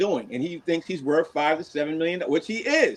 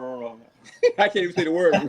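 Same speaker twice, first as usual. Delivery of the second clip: ordinary talk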